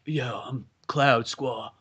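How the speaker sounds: low, subdued game hero; mock main-guy voice. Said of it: "the main guy is like".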